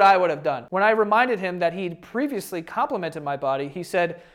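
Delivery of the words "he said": ordinary delivery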